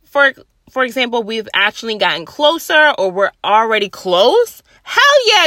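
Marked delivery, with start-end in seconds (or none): 4.8-5.5 high, loud burst of approval; enthusiastic yes. Acted. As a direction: cheer it eagerly. Acts out welcoming a hug.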